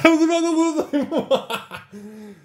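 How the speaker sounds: high laugh through the words; relieved laughter breaking in. Real, not acted. Relief after the big pull.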